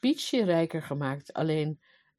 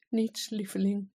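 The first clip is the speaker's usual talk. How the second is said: small, higher, meek voice; sorrowful; 4 semitones higher than usual. A narrator's reading. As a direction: answer sadly, like a meek husband. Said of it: "keek haar bedroefd aan en zei". (bedroefd en bedeesd)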